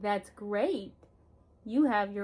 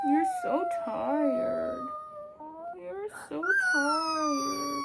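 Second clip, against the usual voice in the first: very high, slow crooning comfort; soothing; 3 dB quieter, much slower than usual. Real, not acted. Mother soothing her tired crying son, crooning. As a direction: croon it to calm him.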